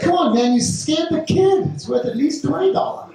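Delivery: hammed-up high-pitched protest; high mock indignant voice. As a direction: raise it high like you're bargaining on the kid's behalf. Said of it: "I said".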